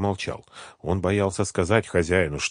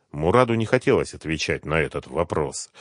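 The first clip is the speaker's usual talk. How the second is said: ordinary delivery, nothing marked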